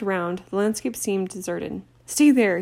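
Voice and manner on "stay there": loud call over howling wind; shouting over the wind. Acted. Harry to his friends over the wind, a loud shout. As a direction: yell it to be heard over a gale. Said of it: "he shouted at the others".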